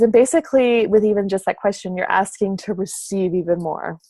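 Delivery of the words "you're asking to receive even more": ordinary talk, nothing marked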